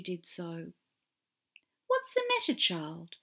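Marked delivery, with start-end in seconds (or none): 1.9-3.2 kindly voice. Acted. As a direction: ask gently, like a fairy godmother. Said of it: "a kindly voice said".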